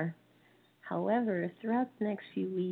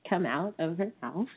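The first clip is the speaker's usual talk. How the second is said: normal talking voice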